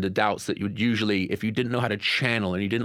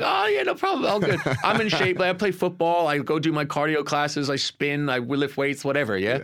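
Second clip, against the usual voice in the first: hammed-up overconfident younger self, very high and loud at first, settling back down; mock your own past swagger with a laugh; mock cocky; 6 semitones higher, 3 dB louder than usual. Mocking his own past overconfidence.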